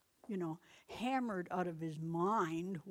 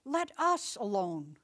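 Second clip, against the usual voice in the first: louder, slow cry of the possessed man; 8 dB louder, slower than usual. A narrator's reading. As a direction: cry out, drawn out and loud. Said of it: "he cried with a loud voice".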